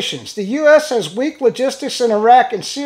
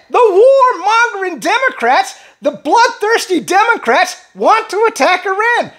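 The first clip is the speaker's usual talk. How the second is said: fired-up rant; 7 semitones higher than usual. Real, not acted. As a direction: rant it out, really worked up. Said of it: "I'm sorry I get so fired up".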